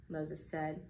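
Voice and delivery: ordinary delivery, nothing marked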